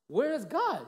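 put-on high, loud child's question; mock bafflement. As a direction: demand it like a puzzled schoolboy. Child questions teacher, retold.

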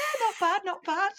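high chirpy brush-off; mock British politeness. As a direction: parody a stiff upper lip. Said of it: "such a British thing to do".

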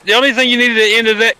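normal talking voice

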